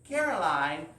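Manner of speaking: ordinary delivery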